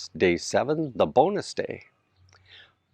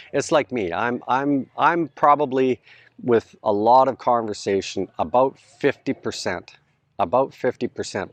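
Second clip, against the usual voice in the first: ordinary delivery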